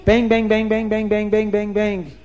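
high, drill-like string of bangs; rapid pecking bangs. Acted. Bird pecking a head like a drill, rapid banging.

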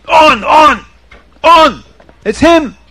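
very high, loud shouted "On, on, on!"; police chief roaring his verdict; climactic shout. Acted. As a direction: bellow it out in certainty.

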